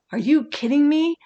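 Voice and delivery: normal talking voice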